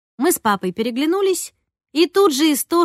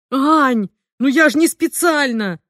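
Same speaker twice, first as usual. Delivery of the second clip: upset; 4 semitones higher, 5 dB louder, slower than usual. A narrator's reading. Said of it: "расстроился папа".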